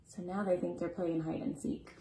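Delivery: ordinary delivery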